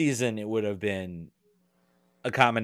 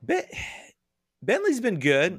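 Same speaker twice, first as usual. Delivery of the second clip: surprised; 9 semitones higher, slower than usual. Real, not acted. Reacting to Bentley suggestion.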